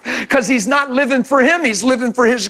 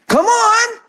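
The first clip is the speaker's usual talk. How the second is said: normal talking voice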